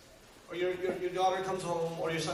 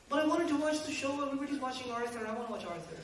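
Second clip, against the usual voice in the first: put-on whiny child; 7 semitones higher than usual. Child whining about TV.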